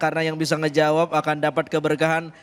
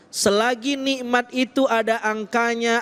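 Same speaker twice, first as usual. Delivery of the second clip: usual speaking voice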